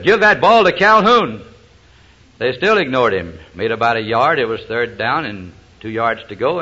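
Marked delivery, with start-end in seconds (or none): 0.0-1.4 high, loud, slowed-down retold order; acted-out coach losing patience; upset coach yelling. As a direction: yell it down the field, more upset. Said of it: "got a little more upset".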